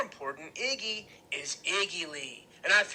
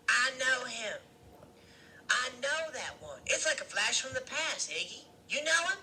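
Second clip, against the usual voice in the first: high woman's voice, musing; Loretta's character voice; 9 semitones higher than usual. A narrator's reading. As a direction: recognize someone in a higher character voice. Loretta's voice in narrated story.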